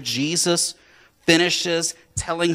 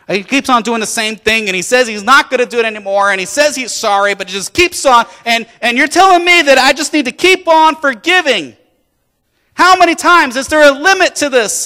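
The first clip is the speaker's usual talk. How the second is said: loud, high-pitched exasperation, building; exasperated complaint; 11 semitones higher, 10 dB louder than usual. Acted. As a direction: protest louder and higher, fed up with forgiving. Peter acted complaining to Jesus about forgiving.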